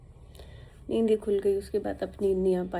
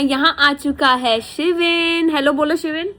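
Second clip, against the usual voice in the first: excited announcing; 6 semitones higher, 12 dB louder than usual. Real, not acted. Aunt introducing her nephew to the vlog, loud excited call. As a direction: call out brightly to a little one.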